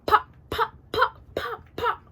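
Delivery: short, high-pitched popping sounds; high little "pop" noises; imitated gunshot pops. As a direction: mimic distant gunfire with a high pop. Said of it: "I just remember hearing".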